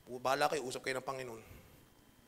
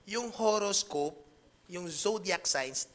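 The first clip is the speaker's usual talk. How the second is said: slow emphasis; much slower than usual. Real, not acted. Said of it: "Uulitin ko lang".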